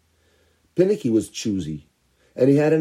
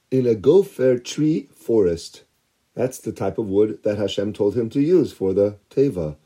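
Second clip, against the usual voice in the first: ordinary delivery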